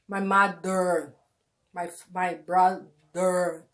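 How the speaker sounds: mother and brother with a d, mudder, brudder; wrong d sound. Shown as a demonstration. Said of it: "Completely wrong".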